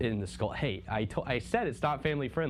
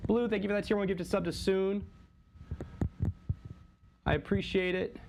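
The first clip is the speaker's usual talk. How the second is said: excited thanks; 9 semitones higher than usual. Real, not acted. Thanking viewer for gifted sub.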